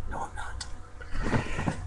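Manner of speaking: slow breathless whisper, barely there. Acted. Narrator denying he is a rat, in a slow whisper. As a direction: hush it to yourself like a secret. Denies being a rat aside.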